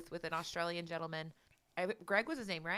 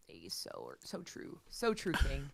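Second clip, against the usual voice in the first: mock reverent murmur; 6 semitones lower, 8 dB quieter, much slower than usual. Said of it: "I'm like".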